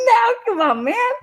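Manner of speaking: mock-whine at the roommate to stop; whiny plea. Sprayed in bed by roommate, high whiny plea. Pleading while being sprayed.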